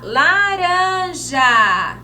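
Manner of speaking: "Laranja" stretched slowly; slow, drawn out. Shown as a demonstration. Teacher saying "Laranja" slowly before writing it. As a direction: say "Laranja" slowly for little ones. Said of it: "Então, vamos lá".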